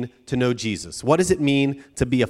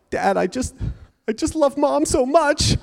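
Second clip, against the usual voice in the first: mock weeping; 11 semitones higher than usual. Weeping daughter to dad, hammed-up high wail. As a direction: pitch climbing into a high wail. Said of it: "And she was weeping".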